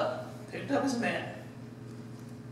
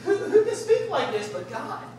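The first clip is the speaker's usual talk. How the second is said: indignant scribes; 9 semitones higher, 3 dB louder than usual. Retold or imitated. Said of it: "now they're going".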